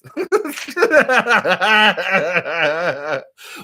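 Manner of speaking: loud, helpless burst of laughter; big belly laugh. Real, not acted. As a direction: crack up completely. Cracks up at fan's message.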